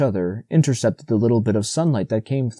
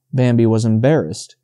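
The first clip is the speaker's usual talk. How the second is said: usual speaking voice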